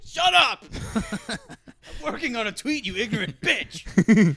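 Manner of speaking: high hammed-up furious yell; angry yelling. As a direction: yell back in mock rage. Tweeter snaps at partner.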